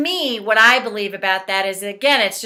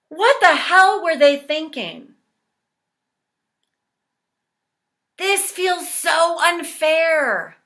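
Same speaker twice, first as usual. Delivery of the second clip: higher-pitched, emphatic indignation; demonstrated angry venting; 5 semitones higher than usual. Shown as a demonstration. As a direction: vent the outrage for others to repeat. Leads angry venting during tapping.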